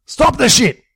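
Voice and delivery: high, loud yell to break up the fight. A narrator's reading. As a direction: bark it to stop the brawl. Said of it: "Jimbo yelled at him".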